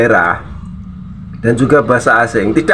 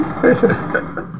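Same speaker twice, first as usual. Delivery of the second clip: breathy amused laugh; quiet unvoiced chuckle; soft laugh; 10 semitones higher, 7 dB quieter than usual. Real, not acted. After mocking the English, soft laugh.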